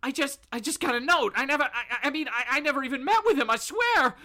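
high, rushed, stammering protest; stuttering, panicked pleading. Acted. Bob swearing to Batman he never met him, panicked. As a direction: swear innocence, climbing into panic. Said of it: "kinda stuttering".

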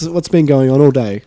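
normal talking voice

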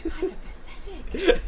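loud scornful laugh. Acted. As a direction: sneer it through laughter.

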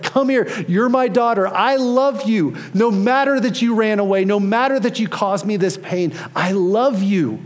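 high, emphatic outpouring of love; impassioned retold reassurance. Father hugging his found daughter, impassioned. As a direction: insist on it earnestly, stretching the declarations of love.

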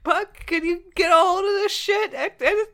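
put-on loud, high slurred drunk plea; town drunk. Drunk bat pleads with Puck.

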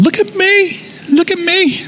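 put-on a vain child begging for attention; show-off pride. Preacher mimics prideful people showing off, high mock voice. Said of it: "pride is us running around naked, going".

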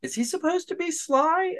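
very high-pitched mock disbelief; puzzled viewer. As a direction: squeak out a baffled question. Acting out a puzzled viewer's reaction.

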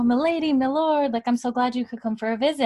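high, gushing retold welcome; delighted greeting. Fergus greets visiting Jamie and Claire, delighted. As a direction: imitate a delighted host greeting guests.

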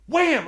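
high punchy wham; sound effect. Retold or imitated. Storyteller punching up the arm twist with a high wham. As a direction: hit it like a comic sound.

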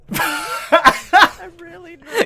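slow, loud anxious wail; nervous. Acted. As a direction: play the panic big and drawn out. Player panicking in character.

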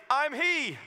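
high, loud declaration; proclaiming Jesus. Acted. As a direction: announce it with full conviction. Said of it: "to say".

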